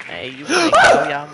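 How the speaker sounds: high laughing tease. Real, not acted. Laughing teasing callout.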